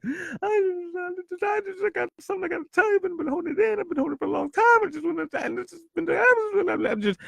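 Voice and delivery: high, crying, broken words through tears; choked, sobbing and halting; imitating sobbing speech. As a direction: mimic blubbering while trying to confess. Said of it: "I probably sounded like that actually".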